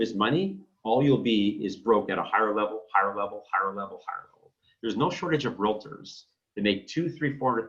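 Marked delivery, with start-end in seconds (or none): 2.9-4.5 a trailing echo that fades to nothing; fading echo. Shown as a demonstration. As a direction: let the phrase echo and fade out. Echoing his own phrase for effect.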